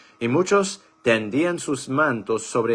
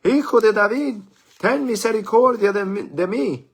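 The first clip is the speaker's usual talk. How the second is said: loud high desperate cry; shouting out; 13 semitones higher, 8 dB louder than usual. A narrator's reading. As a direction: call out across the crowd, pleading. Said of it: "comenzó a dar voces diciendo". (gritando fuerte y suplicante)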